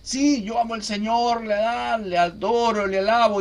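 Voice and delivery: mock emotional gushing, pitched high; imitating a believer carried away in praise; emotional devotee. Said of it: "hay muchas personas que son emocionales".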